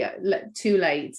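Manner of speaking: ordinary talk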